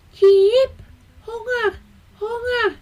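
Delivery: very high chirp, then small piping pleas; hungry baby bird. A narrator's reading. Tired little bird begs for food, high piping voice. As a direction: squeak it out like a tiny fledgling. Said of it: "hij riep weer".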